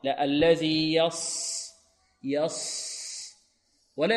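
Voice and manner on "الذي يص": very slow الذي يص with rounded lips on ص; rounded sad error. Shown as a demonstration. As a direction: drag يص with pursed lips. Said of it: "خطأ".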